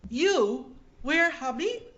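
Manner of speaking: put-on slow, high, foreign-accented "You wear habit?"; a gentle nun asking in broken English; nun's accented question.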